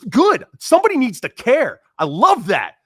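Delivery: gushing approval, pitch way up; excited praise. Retold or imitated. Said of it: "He's like".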